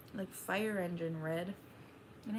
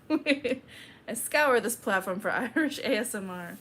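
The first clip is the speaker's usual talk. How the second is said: laughing; 3 semitones higher, 7 dB louder than usual. Real, not acted. Laughing while repeating own line.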